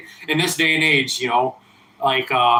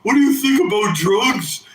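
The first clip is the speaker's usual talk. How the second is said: high, whiny imitation of a nagging fan; mock pestering fan voice; mocking viewer voice; 5 semitones higher than usual. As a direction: mimic a pestering viewer, pitched up. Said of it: "asking me, like".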